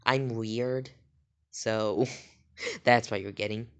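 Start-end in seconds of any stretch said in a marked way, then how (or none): none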